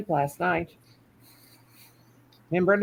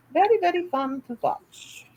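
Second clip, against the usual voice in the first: repeated much higher, singsong; 12 semitones higher than usual. Shown as a demonstration. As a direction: say it again up high and playful.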